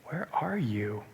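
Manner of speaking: soft, low, tender "where are you"; gentle question. Acted. God calling to Adam and Eve, soft and gentle. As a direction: ask it quietly, like a caring father calling. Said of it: "gently take them where maybe".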